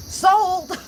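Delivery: high, loud, instant buyer's yelp; mock excited cry. Shopper recalling the new lavender cleaner, mock excited cry. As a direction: blurt it out high and loud, instantly won over. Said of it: "I was like".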